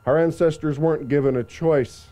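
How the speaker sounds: ordinary talk, nothing marked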